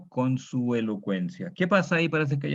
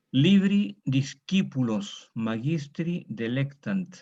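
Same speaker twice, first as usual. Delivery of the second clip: slow model reading; much slower than usual. Shown as a demonstration. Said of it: "reads Latin sentence slowly aloud".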